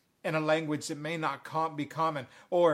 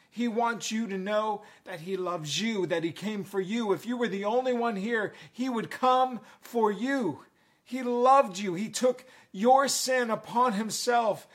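raised, urgent gospel appeal; higher-pitched, insistent pulpit delivery; impassioned preaching; 4 semitones higher, 4 dB louder than usual. Real, not acted. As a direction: preach it with rising fervor.

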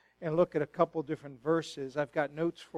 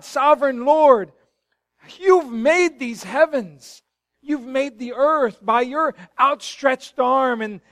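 higher, louder, slow cry of awe and pain; 7 semitones higher, 12 dB louder than usual. Shown as a demonstration. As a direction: pour out the verse like it aches inside. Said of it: "spoke of a painful feeling on the inside".

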